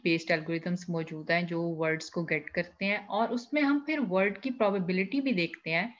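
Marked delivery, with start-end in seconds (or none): none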